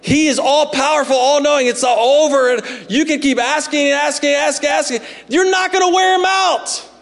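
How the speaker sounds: preaching. Acted. Preaching at full force.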